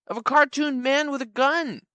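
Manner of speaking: ordinary talk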